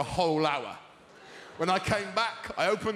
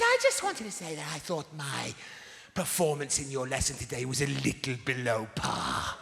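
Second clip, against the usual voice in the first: prim housewife; 3 semitones lower than usual. Retold or imitated. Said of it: "like a repressed nineteen forties housewife".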